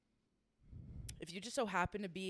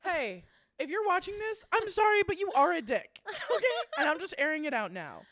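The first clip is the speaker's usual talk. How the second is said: high, loud call-out with laughs; raised, exasperated mock apology; comic venting; 9 semitones higher, 7 dB louder than usual. Real, not acted. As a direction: tell them off, half laughing. Airs grievance directly to the magician.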